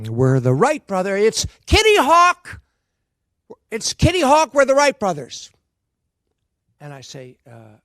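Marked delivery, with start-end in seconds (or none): 0.0-5.5 loud, high, rushed pitch of the scene; frantic improv pitch. Retold or imitated. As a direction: sell the Wright brothers bit in a panic.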